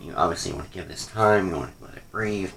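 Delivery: normal talking voice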